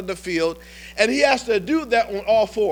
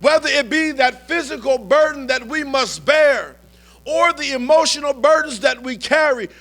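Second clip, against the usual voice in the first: preaching at full force; 4 semitones higher than usual. Acted. A preacher rallying the congregation to conquer their burdens, rising and forceful. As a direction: build it to a triumphant climax. Sermon builds to climax.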